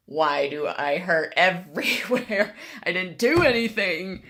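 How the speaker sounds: slow, pained whine with a laugh; whiny complaint. Retold or imitated. Waking up sore, whining to herself about the pain. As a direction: groan it out like waking up aching.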